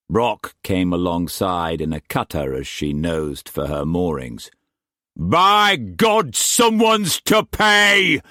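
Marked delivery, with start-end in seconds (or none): 5.2-8.2 roaring with rage as he boards; angry captain roaring on deck; furious roar. A narrator's reading. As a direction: bellow it, slow and much higher and louder. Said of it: "he roared as he came on deck".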